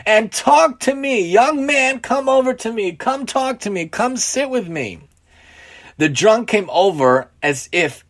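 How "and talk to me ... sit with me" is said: put-on high, beckoning old man's call; friendly calling out. A narrator's reading. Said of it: "in a friendly manner".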